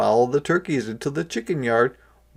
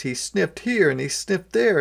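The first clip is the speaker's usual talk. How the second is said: ordinary delivery, nothing marked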